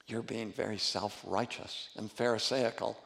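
soft, low, gently firm rebuke; gentle but bold rebuke. Retold or imitated. Pastor retelling a leader gently rebuking self-righteous people, soft and low. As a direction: correct them softly but without backing down. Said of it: "Very gently, but boldly".

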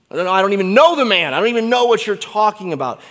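fast, loud, high flustered denial; hurried frantic denial; panicked denial. Acted. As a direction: blurt it out in a rush.

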